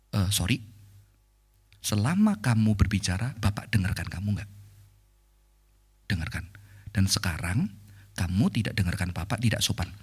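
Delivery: low, measured teacher voice; gentle, dignified reproof. Retold or imitated. Teacher correcting a rude pupil, gentle and dignified. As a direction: correct the child calmly, keep the voice low. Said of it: "Bisa kata ini dengan lembut, dengan dignity".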